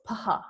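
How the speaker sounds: "paha" as puh-ha; two-syllable "paha", never fuh; no F sound. Shown as a demonstration. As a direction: model "paha" with p then breathed h. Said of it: "there is no F sound in Sanskrit".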